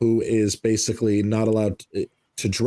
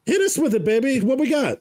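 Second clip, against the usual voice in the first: hype-up voice. Real, not acted. Host hyping guest, high pitched.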